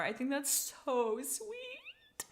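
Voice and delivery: slow, breathless whisper; a soft, slow aside that melts over the pairing; whispered gush. Real, not acted. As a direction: hush it like a secret crush. Gushing over a cat ship.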